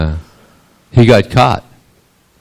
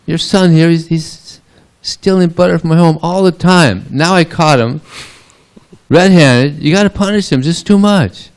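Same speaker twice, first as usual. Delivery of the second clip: high-pitched indignant tirade; acted exasperated complaint; 11 semitones higher, 4 dB louder than usual. Gopi demanding Yasoda punish her son, exasperated. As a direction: complain shrilly as a fed-up neighbour.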